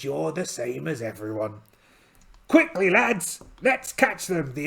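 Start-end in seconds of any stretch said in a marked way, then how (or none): 2.5-4.5 rallying call. Acted. Captain calls to his crew.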